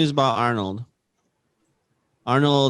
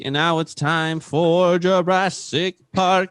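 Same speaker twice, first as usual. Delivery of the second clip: slow, drawn-out announcer voice a bit higher; announcer intro; 4 semitones higher, 3 dB louder, slower than usual. Acted. Host slowly announces the Jurassic Park segment title. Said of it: "segment title intro".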